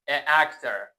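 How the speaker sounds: loud "A actor", the two vowels separated by a catch; throat-straining vowel clash. Shown as a demonstration. Teacher shows why it is hard, "A actor" with clashing vowels. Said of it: "به حنجره فشار میاد".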